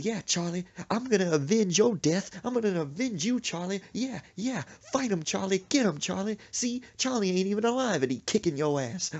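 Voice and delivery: high, fired-up cheering of a mock avenger; gleeful vengeance. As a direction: egg on your champion mid-fight. Mother avenges fish in headlock.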